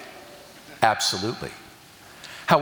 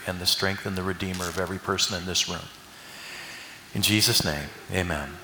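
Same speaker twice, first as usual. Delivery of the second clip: prayer; 6 semitones lower than usual. Acted. Preacher leads an opening prayer.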